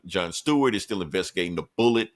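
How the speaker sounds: usual speaking voice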